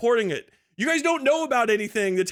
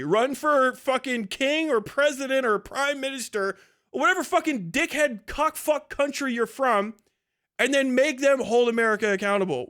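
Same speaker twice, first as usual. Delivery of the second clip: ordinary talk, nothing marked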